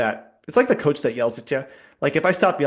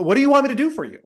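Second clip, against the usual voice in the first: exasperated; 12 semitones higher, 4 dB louder, faster than usual. Retold or imitated. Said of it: "I'm just kinda like".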